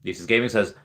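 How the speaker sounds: normal talking voice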